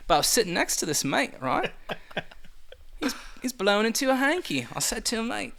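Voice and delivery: put-on Aussie storyteller; Australian accent. Shown as a demonstration. Telling of a sick mate at the pub, Australian accent. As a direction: spin a pub yarn in mock Australian. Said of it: "pretend you have a cold in an Australian accent".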